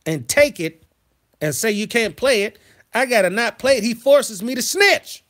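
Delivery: worked-up retelling, loud and high; furious rant. Retold or imitated. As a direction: let the outrage build as you tell it. Retelling fury at mother.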